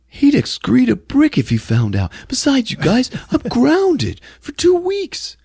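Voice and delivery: high put-on kid whine; squeaky protest, dragged out at the end; whiny brother. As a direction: imitate a kid pleading his excuse. Acting Melvin's whiny excuse.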